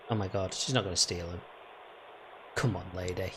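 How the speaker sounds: low, soft muttering. Real, not acted. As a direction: mutter at the thief. Muttering at thief.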